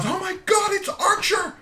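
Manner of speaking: imitating wife's high-pitched, thrilled scream; mock squeal at full volume; excited scream. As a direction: shriek it like an overjoyed dog fan. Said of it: "and she screams".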